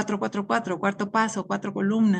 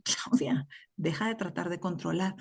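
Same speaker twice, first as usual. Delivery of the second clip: soft whisper to herself, much softer; whispered self-talk; 3 semitones lower, 8 dB quieter than usual. Retold or imitated. Speaker whispering her own name to stop controlling, softly. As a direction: hush it like a private reminder.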